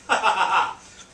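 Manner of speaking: loud, high burst of laughter. Real, not acted. Speaker amused by his dinner offer, loud laugh.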